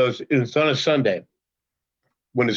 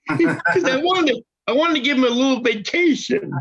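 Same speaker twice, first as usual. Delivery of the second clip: laughing while talking; 12 semitones higher than usual. Real, not acted. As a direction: chuckle out the words as you go. Laughs while telling prank.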